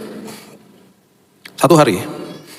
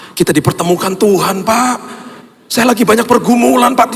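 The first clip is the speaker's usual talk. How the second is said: crying driver; 10 semitones higher than usual. Retold or imitated. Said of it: "Dia, dia nangis".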